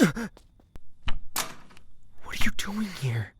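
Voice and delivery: hushed, startled whisper; surprised whisper. Acted. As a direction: whisper it so nobody outside hears. Startled, hushed after being shushed.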